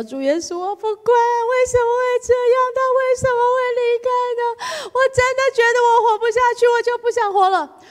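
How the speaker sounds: high, loud sobbing wail; mock wailing cry. Heartbroken woman crying out to Jesus, sobbing wail. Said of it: "我觉得说：".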